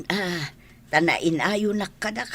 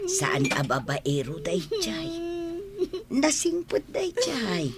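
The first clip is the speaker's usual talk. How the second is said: whisper; 9 semitones higher, 3 dB quieter than usual. Acted. Aunt confides about the suitor.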